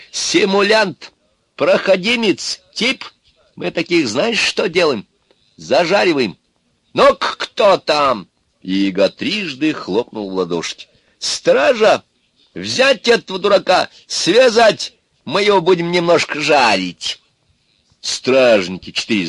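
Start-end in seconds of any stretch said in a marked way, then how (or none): 0.1-8.3 high-pitched, angry crone's threats; threatening Baba Yaga. Acted. Baba Yaga threatens to roast Ivan, angry. 11.2-17.2 high, loud witch's orders; shouted command. Acted. Baba Yaga orders guards to seize Ivan, shouting.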